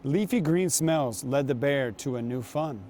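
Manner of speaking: ordinary delivery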